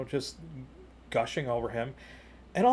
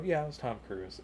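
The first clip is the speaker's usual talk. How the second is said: nonchalant, softer reply; unimpressed; 5 dB quieter than usual. Retold or imitated. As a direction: play it cool, no fanboying, voice down. Unimpressed reply, doesn't fanboy over people.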